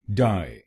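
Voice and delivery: डाई said alone, a clear dai; syllable demo. Shown as a demonstration. Teacher drills the first syllable. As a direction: isolate डाई as a single syllable. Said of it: "पहले शब्दांश को बोलिए डाई".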